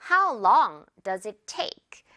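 very slow, each word spaced out; slow model sentence. Shown as a demonstration. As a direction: teach the sentence slowly. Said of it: "take란 단어가".

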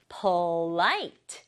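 "polite" with the second syllable stretched and rising; stress on LITE. Shown as a demonstration. Teacher blends sounds for pupils, "polite" stressed on LITE. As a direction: lean long on the "lite" of "polite". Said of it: "강조를 light 부분에 줘야 돼요".

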